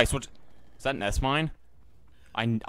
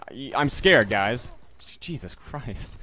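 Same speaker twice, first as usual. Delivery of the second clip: ordinary talk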